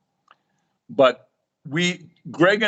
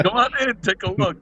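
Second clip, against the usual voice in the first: welcoming host; 9 semitones higher, faster than usual. Retold or imitated. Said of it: "it's like".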